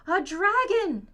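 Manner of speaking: high, loud, awed cry; amazed gasp. A narrator's reading. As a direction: gasp it out in wonder. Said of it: "gasp Sam and Willa".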